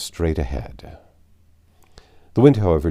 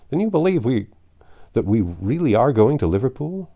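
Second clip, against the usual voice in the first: higher and louder, disbelieving; incredulous question; 6 semitones higher, 5 dB louder than usual. A narrator's reading. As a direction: ask it as if you can't believe it. Fix asks in disbelief.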